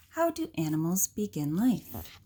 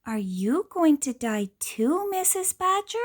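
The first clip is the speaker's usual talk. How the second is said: put-on higher child's question; worried child; 7 semitones higher than usual. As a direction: ask like a worried youngster. Child asks Missus Badger.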